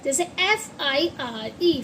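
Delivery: ordinary talk, nothing marked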